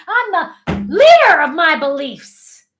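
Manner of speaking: loud, high, slow and emphatic; like you mean it. Shown as a demonstration. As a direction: drive the words home with full conviction. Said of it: "say it like you mean it".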